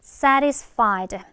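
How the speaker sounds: "satisfied" as SA-ris-fied, slowly modelled; t weakened to r. Shown as a demonstration. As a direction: flap the t in "satisfied" like a light r. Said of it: "요거 발음 주의하세요".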